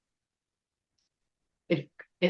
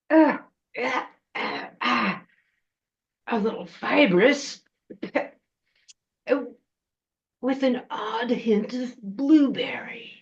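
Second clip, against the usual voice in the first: high creature voice, picky food critic; smacking, pondering witch voice; troll witch tasting; 5 semitones higher than usual. Acted. Troll witch judging the taste of a coat, fussy creature voice.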